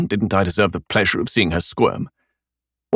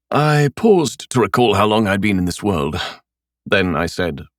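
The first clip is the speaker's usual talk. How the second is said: ordinary delivery, nothing marked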